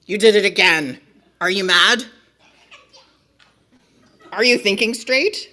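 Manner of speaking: loud, incredulous scolding questions; outraged. Acted. Book character after reader did it again, outraged. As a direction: challenge the reader's sanity at full volume.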